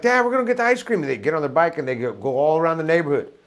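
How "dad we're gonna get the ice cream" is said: put-on high, loud kid voice rushing out; excited kids. Kid tells dad about the ice cream truck, excited. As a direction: squeal it like a thrilled kid.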